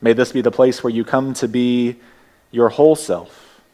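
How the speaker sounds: ordinary talk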